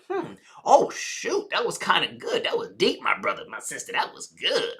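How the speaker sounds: soft, impressed musing to oneself; pondering audience member. Acted. Audience member chewing on what was said, softly impressed. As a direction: mull it over quietly, savouring it. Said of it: "They start chewing on it, like".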